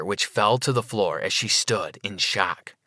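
ordinary delivery, nothing marked

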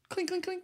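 high, soft tinkling imitation; small, high-pitched clinks; imitated metallic clinking. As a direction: mimic a shell casing bouncing lightly. Imitating shell casing hitting floor.

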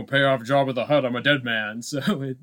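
ordinary talk, nothing marked

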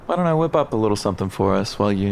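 ordinary talk, nothing marked

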